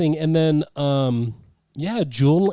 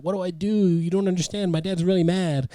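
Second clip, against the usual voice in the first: higher, lightly acted distress; upset; 6 semitones higher than usual. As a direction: plead helplessly, half in jest. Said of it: "and he was upset".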